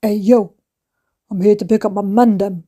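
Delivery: a playful take on a London roadman voice, lightly voiced; mock roadman. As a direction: mum hamming up teen street slang. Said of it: "And I'm gonna say to the office woman".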